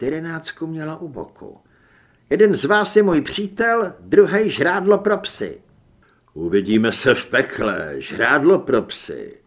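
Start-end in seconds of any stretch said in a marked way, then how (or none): 2.3-5.6 higher, louder character voice, commanding; Julie's threat. Acted. Julie threatens the two lookalikes. 6.3-9.4 low, loud, mocking retort; sneering double. A narrator's reading. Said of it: "už klíbil se doppelganger".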